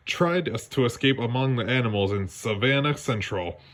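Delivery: ordinary delivery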